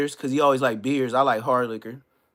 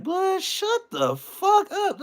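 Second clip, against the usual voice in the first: much higher, slow, put-on voice; mock dismissive retort; much slower than usual. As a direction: drag out a whiny shut-up. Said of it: "You're like".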